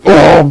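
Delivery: loud high yelp of pain; pained cry. Acted. The door stabbed in the eye, sharp loud yelp.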